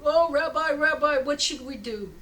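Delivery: loud, high, hammed-up appeal; acted pleading. Villager begging his rabbi for help, acted pleading. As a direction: plead in mock desperation.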